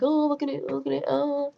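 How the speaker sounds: high, put-on cooing imitation; doctor examining. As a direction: mimic a doctor oohing over an injury.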